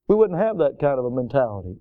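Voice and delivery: ordinary talk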